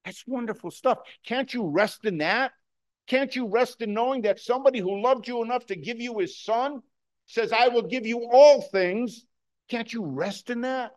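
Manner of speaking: high-pitched, fervent appeal to the congregation; excited preaching. Real, not acted. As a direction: plead with them, carried away by real excitement. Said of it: "I get excited".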